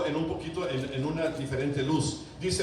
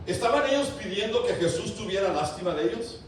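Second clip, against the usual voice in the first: ordinary talk, nothing marked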